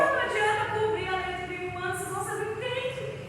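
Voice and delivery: bronca de mãe reencenada, alta e bem aguda; exaltada, subindo muito a voz, como revivendo a discussão. Retold or imitated. (mãe perdendo a paciência, em voz alta e aguda)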